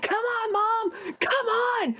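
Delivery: put-on high, eager child's voice urging mom on; excited little girl. Daughter urging mom through the wrestling crowd, high and eager. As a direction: voice your daughter tugging you through the crowd. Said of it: "She's like".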